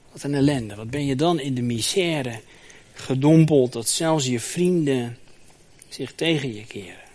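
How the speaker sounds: usual speaking voice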